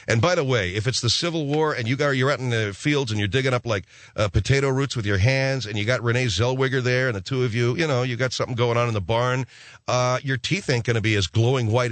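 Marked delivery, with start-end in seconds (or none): none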